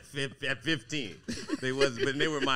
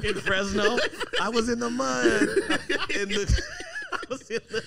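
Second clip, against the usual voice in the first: higher, louder wail broken by laughs; laughing mock lament; 3 dB louder than usual. Joking to friends about high school, laughing mock wail. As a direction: cry it out as a joke, cracking up. Hammed-up war story lament.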